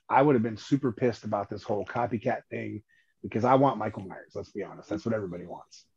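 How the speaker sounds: usual speaking voice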